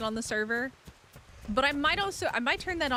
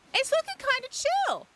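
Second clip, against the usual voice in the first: high squeaky; 3 dB louder than usual. Pretend. Same phrase squeaked very high.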